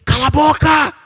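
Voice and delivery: high, harsh shout to silence; crowd shout. Retold or imitated. The crowd shouting the blind man down, high and loud. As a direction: yell him down. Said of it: "a multidão aumentava o coro".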